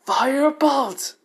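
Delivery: very high, loud, drawn-out yell; full-voiced high attack shout; screamed battle cry. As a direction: scream the spell name, stretching it. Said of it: "As he screams that out".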